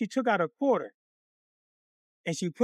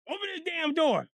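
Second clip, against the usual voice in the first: high-pitched acted outrage; angry demand; 6 semitones higher, 3 dB quieter than usual. Mother knocks on Miss Johnson's door, angry demand.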